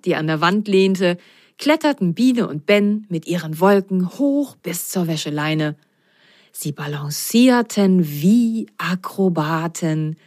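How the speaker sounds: usual speaking voice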